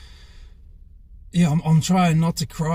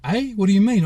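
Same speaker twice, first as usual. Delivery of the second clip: higher, louder startled "eh?"; retold disbelief; 5 semitones higher, 4 dB louder than usual. Player questioning the physio about this week, taken aback. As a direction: act out being caught off guard. Confused at being told he won't play.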